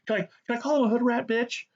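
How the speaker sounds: high, put-on voice mimicking the host; mocking whiny guy. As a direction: squeak it out like a mocking caricature. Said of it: "when he's describing those videos, like".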